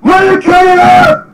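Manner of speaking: high, loud, drawn-out scream; Squidward's furious-sounding shriek. A narrator's reading. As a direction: burst in screaming. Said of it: "busted through the door and screamed".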